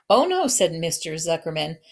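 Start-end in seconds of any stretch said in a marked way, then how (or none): 0.1-0.5 emphatic protest. Acted. Husband firmly contradicts wife.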